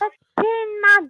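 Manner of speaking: high, squeaky correction. Real, not acted. Caller putting radio host right about his name, high voice. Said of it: "you got a really high voice".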